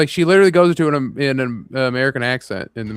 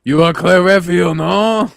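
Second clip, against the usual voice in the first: a mock French hostess, higher and louder; hammed-up French accent; 4 semitones higher, 4 dB louder than usual. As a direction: overdo a French welcome at the door. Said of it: "terrible French accent".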